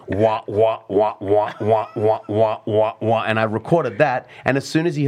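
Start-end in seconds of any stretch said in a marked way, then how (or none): 0.1-3.2 imitated ragga toasting. Kid toasting over the loop, chanted wahs. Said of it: "he starts going".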